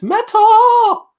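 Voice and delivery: very high, loud sustained scream; full-throated, high-pitched mock metal yell; imitated metal scream. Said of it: "and Henning screamed".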